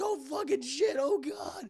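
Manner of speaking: high mock panic; panicked Han. Streamer voices Han panicking over Leia, high mock panic.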